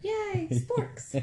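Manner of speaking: high, squealy fake joy; mock delight. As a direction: cheer sarcastically at the sporks. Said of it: "You'd be like".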